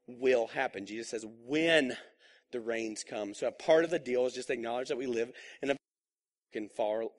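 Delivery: ordinary talk, nothing marked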